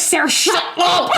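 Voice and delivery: playful high yell at a friend; mock shout. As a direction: snap it out half-laughing. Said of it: "And I was like".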